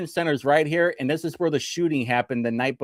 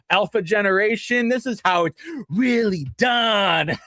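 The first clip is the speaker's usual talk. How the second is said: higher, mock-preachy lecture to the kids; mocking bragging taunt; 8 semitones higher than usual. Johnny lecturing the children after flexing on them, smug taunt. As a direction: gloat like a smug know-it-all grown-up. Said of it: "Brick City Johnny was like".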